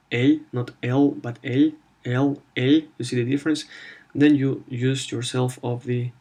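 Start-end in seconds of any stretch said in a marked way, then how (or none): 0.1-2.8 L said soft, palatalized like "ly"; soft Russian L. Shown as a demonstration. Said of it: "If you wanna make it sound soft".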